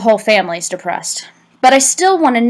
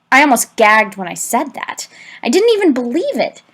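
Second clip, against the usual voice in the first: plain reading voice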